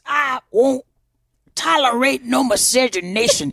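hammed-up Southern accent, indignant refusal. Southerner making fun of Southerners to a co-host, Southern accent. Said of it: "say it with a Southern accent".

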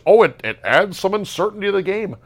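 high, far-off mocking voice of a fan defending the rule; prissy mock quote in a put-on voice; mock defender.

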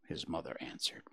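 usual speaking voice

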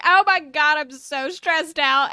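loud, slow mock stressed cry; stressed yell. A worker on a terrible day, loudly stressed out. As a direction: wail it like a frazzled worker. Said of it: "you're like".

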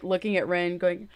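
ordinary talk

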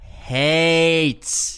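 "Hates" punched out loud and high; emphatic repeat. Real, not acted. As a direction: hammer the word "Hates" home. Repeats key word emphatically.

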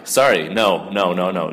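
ordinary talk

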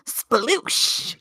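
a hint of Pam from Archer; drawn-out breathy sploosh. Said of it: "it's Pam from Archer going".

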